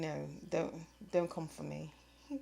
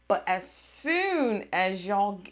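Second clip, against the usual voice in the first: emphatic; 3 semitones higher, 10 dB louder, slower than usual. Real, not acted. Emphatic opening of a rant.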